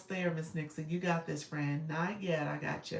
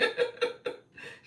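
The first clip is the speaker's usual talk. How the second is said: high breathy giggle; 5 dB louder than usual. Real, not acted. Keeps laughing.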